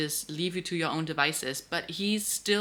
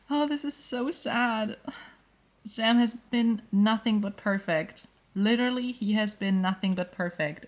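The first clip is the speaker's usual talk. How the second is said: genuinely upset; 4 semitones higher than usual. Real, not acted. Upset at sad plot turn.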